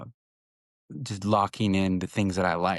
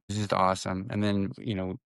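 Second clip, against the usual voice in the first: normal talking voice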